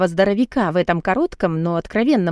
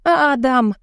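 higher, pleading whimper; 6 semitones higher, 4 dB louder than usual. A narrator's reading. Woman overwhelmed by Adam's questions, whimpering his name. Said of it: "заскулила я".

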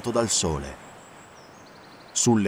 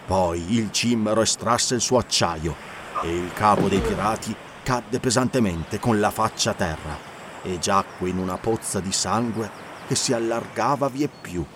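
ordinary delivery

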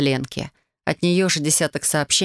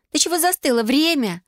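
high whiny hurry-up; whining; 10 semitones higher than usual. Acted. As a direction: whine at her to hurry. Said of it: "заныла Ульяна".

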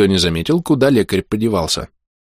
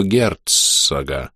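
герцога drawn out very slow and low; герцога stretched, hissed deep; whistling s; 7 semitones lower, 3 dB quieter, much slower than usual. Acted. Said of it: "странным свистящим голосом".

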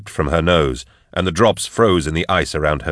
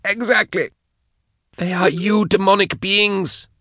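very high, strained voice; high-pitched compelled confession; tormented captive. A narrator's reading. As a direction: agree in a fervent high voice. Tormented captive confessing under coercion.

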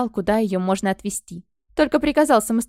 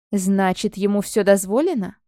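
ordinary delivery

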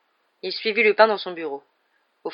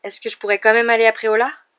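higher, louder, hopeful request; pleading question; 4 semitones higher, 7 dB louder than usual. A narrator's reading. Pupil asks his teacher to let him go to the village, pleading. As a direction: ask as an eager pupil wanting permission.

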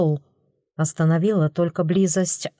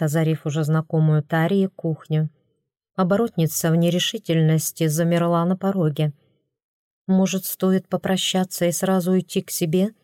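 ordinary delivery

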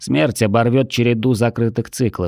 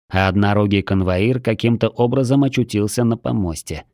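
usual speaking voice

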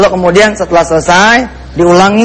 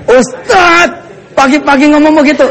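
protesting listener; 7 semitones higher than usual. Acted. Listener objecting to the ustadz's morning topic, high mock protest. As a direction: imitate a scandalized listener, pitched up.

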